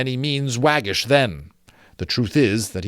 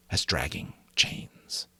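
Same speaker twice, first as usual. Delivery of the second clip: slow spooky whisper; eerie whisper; 6 semitones lower, 8 dB quieter, slower than usual. A narrator's reading. Narrator hushing the ghost story, slow whisper. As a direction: breathe it out slowly and eerily.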